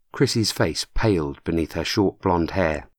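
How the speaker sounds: plain reading voice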